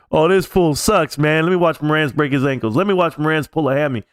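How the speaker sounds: roast him from the stands; mocking heckler. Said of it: "and right there, Stacks".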